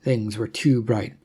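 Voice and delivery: usual speaking voice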